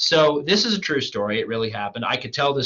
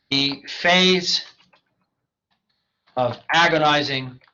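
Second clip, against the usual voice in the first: dramatic reading; slower than usual. Acted. Teacher reads sample essay dramatically.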